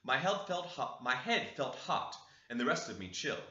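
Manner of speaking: ordinary delivery, nothing marked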